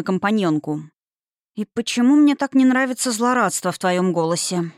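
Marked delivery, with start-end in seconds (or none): none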